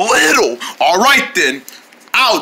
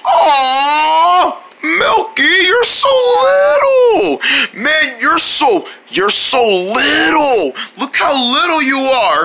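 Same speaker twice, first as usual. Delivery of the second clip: very high, loud squeals over something tiny; squealing delight; 8 semitones higher, 3 dB louder, much slower than usual. Acted. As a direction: squeal and coo, half crying with glee. Friend shrunk tiny.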